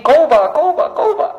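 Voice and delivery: high, repeated bird cry; birdcall imitation. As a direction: sing out the syllables like a bird. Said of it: "Another bird is singing".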